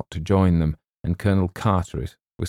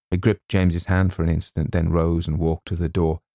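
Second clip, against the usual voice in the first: plain reading voice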